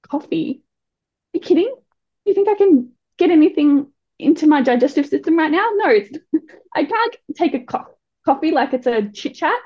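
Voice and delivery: can't believe what she's hearing; incredulous outrage. Real, not acted. Mother recalling being told to get coffee, incredulous and outraged. Indignant reaction to doctor's advice.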